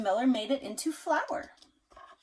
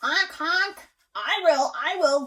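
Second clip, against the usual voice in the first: loud honking goose; eager goose; 5 semitones higher, 8 dB louder, slower than usual. Acted. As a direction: honk keen to eat. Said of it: "the goose said".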